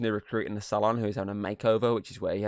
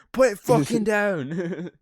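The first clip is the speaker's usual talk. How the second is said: high, slow, drawn-out angry yell; mock furious yell; 11 semitones higher, 5 dB louder, much slower than usual. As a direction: spit each word out, loud and slow. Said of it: "starts yelling at him".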